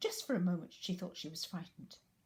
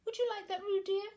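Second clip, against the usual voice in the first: high, louder teasing question; Kanga, teasing; 7 dB louder than usual. Acted. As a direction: sweetly tease the wrong baby. Kanga teases.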